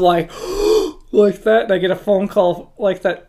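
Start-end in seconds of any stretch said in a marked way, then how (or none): 0.4-1.1 sharp high breathless gasp; shocked gasp. Retold or imitated. Driver sees the cyclist fly into the ditch, sharp gasp. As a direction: gasp like you just saw a crash.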